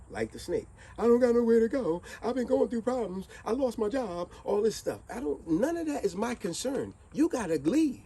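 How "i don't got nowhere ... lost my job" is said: mocking whine. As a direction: mimic a freeloader's excuses. Mocking tenant excuses, louder.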